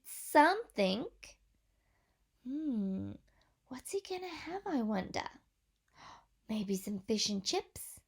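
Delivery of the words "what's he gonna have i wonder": ordinary delivery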